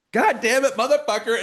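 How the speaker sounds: high, loud re-enacted outburst; retold temper tantrum. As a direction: curse like you're losing it in a retold tantrum. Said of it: "had a temper tantrum".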